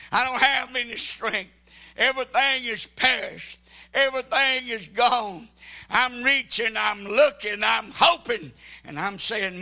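impassioned sermon, rising to shouts; preaching. Acted. Pastor preaching hard to his church.

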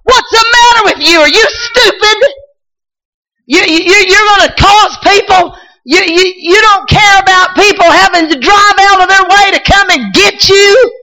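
put-on high, strained yelling at a child; daddy's angry scolding. Preacher voicing her angry father yelling at her. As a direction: yell it like a bad-tempered father. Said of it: "he would scream at me".

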